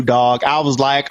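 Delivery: ordinary delivery, nothing marked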